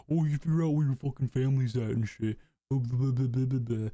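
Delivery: mock the opponent's babble; dismissive mimicry trailing into blahs; mocking imitation. User parodies the character's argument, mocking. Mockingly quoting the other person.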